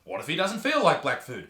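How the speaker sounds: ordinary talk, nothing marked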